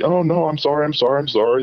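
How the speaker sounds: acting out his own embarrassed self; flustered apology. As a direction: say sorry in a flustered rush. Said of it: "I'm like".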